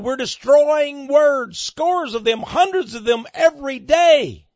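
high, loud, mounting zeal; exultant declaiming. Acted. Preacher reading the dictionary bureaucrat to Winston, exultant shout.

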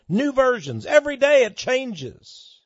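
high, loud outburst of alarm; preaching shout. Real, not acted. Preacher warning of ever-changing Bible versions, high shout. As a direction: cry it out high and loud to the congregation.

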